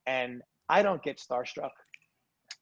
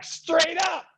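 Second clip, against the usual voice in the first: excited disbelief; 13 semitones higher than usual. Retold or imitated. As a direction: yell it like you can't believe your luck. Excited shocked reply, retold.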